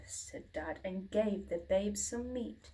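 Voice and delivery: ordinary delivery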